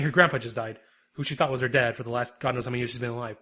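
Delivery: normal talking voice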